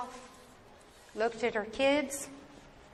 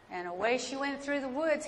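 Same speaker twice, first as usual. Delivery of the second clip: ordinary delivery, nothing marked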